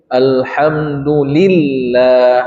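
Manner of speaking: alhamdulillah very slowly, the doubled l of Allah pressed. Shown as a demonstration. Teacher shows the class the tasdid in Allah, slowly. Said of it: "setiap lafaz Allah itu tasdid".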